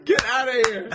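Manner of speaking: high, laughing, outraged shout; laugh-shout the protest; laughing outrage. Real, not acted. Laughing outrage at friend's answer.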